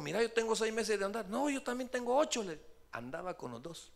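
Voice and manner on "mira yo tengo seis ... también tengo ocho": heated quarrel. Retold or imitated. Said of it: "le dijo".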